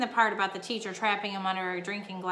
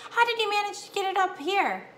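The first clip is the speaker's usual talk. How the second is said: high-pitched amazed question; incredulous; 10 semitones higher, 4 dB louder than usual. A narrator's reading. Boy questioning the mouse about his feat, incredulous. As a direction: ask it high, in wonder. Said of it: "Keith could not believe it".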